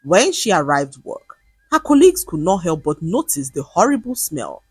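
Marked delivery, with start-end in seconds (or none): none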